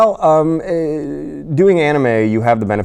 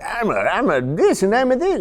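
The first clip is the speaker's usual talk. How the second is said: higher fake character voice; mock overdone voice acting; put-on voice; 3 dB louder than usual. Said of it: "being like".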